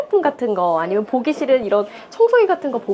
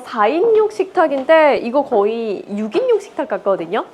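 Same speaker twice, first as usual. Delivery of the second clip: usual speaking voice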